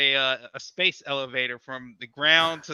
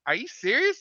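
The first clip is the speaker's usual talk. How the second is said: incredulous; 11 semitones higher than usual. Retold or imitated. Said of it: "I was like".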